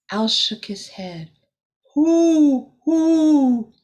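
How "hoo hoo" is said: high, loud hooting owl. A narrator's reading. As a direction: hoot like a wise owl. Owl speaking in story.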